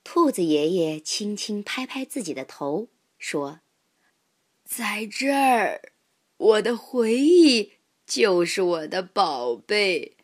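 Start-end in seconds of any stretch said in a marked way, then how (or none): 4.6-10.2 put-on old rabbit grandpa. A narrator's reading. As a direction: savour each word like a kindly elder.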